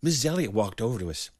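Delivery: plain reading voice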